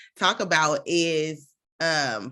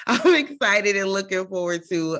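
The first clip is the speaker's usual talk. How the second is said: laughing; 4 semitones higher, 4 dB louder than usual. Real, not acted. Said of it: "laughs, repeated".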